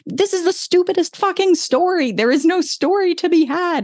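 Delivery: high, exasperated mock rant; high mock outrage at the outlet. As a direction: vent it high in disbelief. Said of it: "I'm like".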